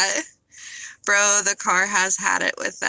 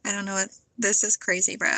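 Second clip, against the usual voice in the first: usual speaking voice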